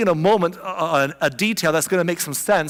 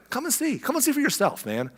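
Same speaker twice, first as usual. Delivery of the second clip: lightly voiced invitation; 7 semitones higher, 5 dB quieter than usual. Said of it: "same thing that Jesus said".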